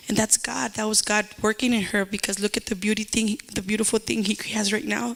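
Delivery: usual speaking voice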